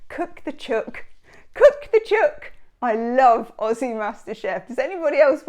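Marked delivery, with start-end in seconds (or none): 0.1-1.0 a light Aussie echo of cook the chook, higher; mild Australian accent. Retold or imitated. Repeating the MasterChef judge, in a light Australian accent. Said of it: "a little Australian slip". 1.5-2.5 put-on cook the chook belted much higher and louder, in a broad Australian accent; hammed-up Australian accent. Cheering along with the Aussie MasterChef judge, in a loud, high Australian accent. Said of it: "a little Australian slip".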